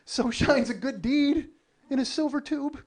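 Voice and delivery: high, slow, wonderstruck recital; touched and reverent, drawing the words out; hammed-up movie-quote voice; Willy Wonka. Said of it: "I felt like Willy Wonka".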